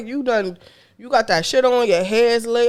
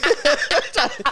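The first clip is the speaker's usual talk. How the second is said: laughing; 6 semitones higher, 3 dB louder, slower than usual. Real, not acted. Cracking up over the date.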